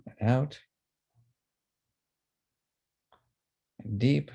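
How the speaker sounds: unhurried meditation guide, leaving room to breathe; guided breathing exercise. Acted. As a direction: lead a group through breaths, drawing out each word.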